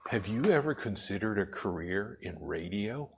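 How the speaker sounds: lightly voiced consultant, leaning in; a hint of a smooth radio voice; consultant's suggestion. Consultant voiced in a retold story.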